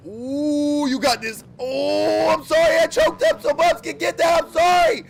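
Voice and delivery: high, loud excited yelps at the game; excited shouting. Real, not acted. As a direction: cheer and apologize at full volume. Reacting to game moment.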